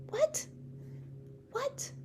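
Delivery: tiny, high, soft questions; hushed high-pitched shock, with pauses; stunned disbelief. Retold or imitated. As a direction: act out being floored, barely getting it out. Acts out her stunned reaction.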